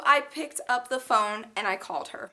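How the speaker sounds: ordinary talk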